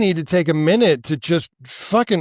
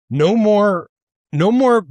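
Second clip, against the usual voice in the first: emphatic; 6 semitones higher, 3 dB louder than usual. Real, not acted. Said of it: "I'm worked up".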